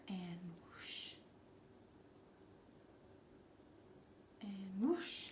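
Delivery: soft unvoiced whisper. Real, not acted. Painter brushing on lashes, whispering.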